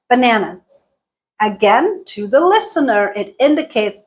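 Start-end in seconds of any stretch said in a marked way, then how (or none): 0.1-0.9 BANANAS dips in pitch to close; final-item fall on BANANAS; falling last item. Shown as a demonstration. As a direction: drop the pitch through BANANAS. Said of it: "our pitch dipped".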